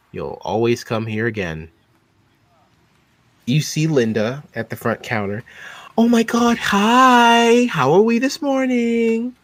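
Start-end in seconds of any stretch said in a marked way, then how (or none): 6.0-9.3 high, loud, bubbly office gossip; Linda's sugary-sweet high hello; gushing receptionist. Acted. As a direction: greet her with over-the-top cheer.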